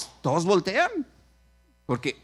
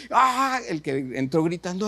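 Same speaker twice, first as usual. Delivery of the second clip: ordinary talk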